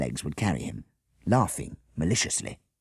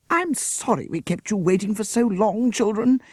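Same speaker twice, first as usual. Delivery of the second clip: breathless; 4 dB louder than usual. A narrator's reading. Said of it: "Miss Beaver said breathlessly".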